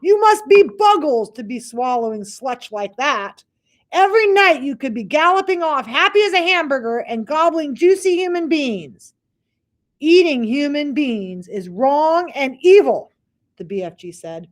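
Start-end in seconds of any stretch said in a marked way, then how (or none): 0.0-9.1 very high, loud disgusted rant; ranting giant. Acted. 10.0-13.1 slow, high, loud earnest reproach; firm BFG. Acted. BFG's moral stand.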